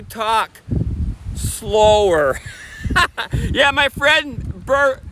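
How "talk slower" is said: slowing down into a drawn-out last word; deliberately slower. Shown as a demonstration. Vlogger telling viewers they were told they talk too fast, deliberately slowing down. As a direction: stretch the final word out slowly, as a joke. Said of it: "I've been told I talk too fast".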